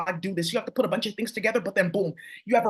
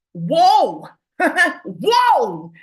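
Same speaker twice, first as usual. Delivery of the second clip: loud, high-pitched whoa with a laugh between; real outburst of surprise; amazed exclamation; 6 semitones higher, 8 dB louder than usual. Real, not acted. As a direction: burst out in loud amazement, voice swooping up. Reacting to what she sees.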